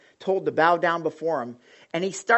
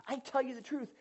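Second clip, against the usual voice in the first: quieter, intimate restating of the promise; soft repeat; 6 semitones higher, 9 dB quieter, faster than usual. Shown as a demonstration. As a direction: say it gently, close to them. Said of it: "same quote repeated softly".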